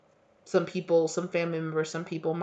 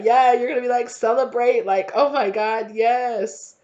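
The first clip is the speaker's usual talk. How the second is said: high and loud, cheering the win; loud happy celebration cry; excited celebrating; 6 semitones higher, 7 dB louder than usual. Acted. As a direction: whoop it up like a party.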